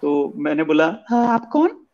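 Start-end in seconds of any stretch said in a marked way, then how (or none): 1.1-1.9 put-on high girlish hello; man doing a woman's voice. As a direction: answer like a young woman. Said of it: "आवाज आई लड़की की".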